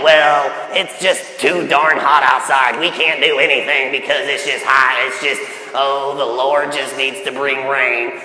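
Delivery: mock whiner, dragging every word out low; negative complainer. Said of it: "a negative person would be like".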